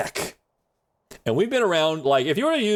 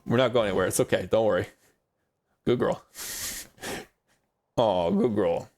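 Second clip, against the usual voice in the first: soft, low reassurance; soothing the dog; 5 semitones lower, 3 dB quieter than usual. Real, not acted. Calming the dog Mia after the key noise, softly. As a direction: settle her back to sleep gently.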